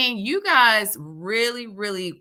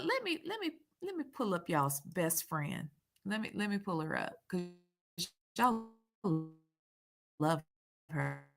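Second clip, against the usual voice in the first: sarcastic aside; 3 semitones lower, 12 dB quieter than usual. Real, not acted.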